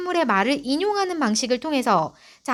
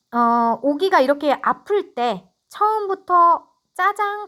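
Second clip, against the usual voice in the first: slow, high and loud read-aloud; emphatic reading; 7 semitones higher, 5 dB louder, slower than usual. Shown as a demonstration. Teacher reading the passage to students, slow and emphatic. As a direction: lean hard on this key line. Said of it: "reading the key line emphatically".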